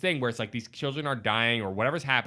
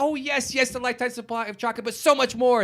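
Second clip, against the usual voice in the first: delighted Wonka; 10 semitones higher than usual. Retold or imitated. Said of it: "He's like".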